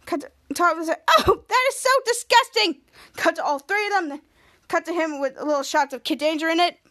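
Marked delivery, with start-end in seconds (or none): 1.1-2.8 high-pitched acted revulsion; disgusted. Toddler after drinking man's sweat, high disgusted groan. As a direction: recoil from a foul taste, imitating a character.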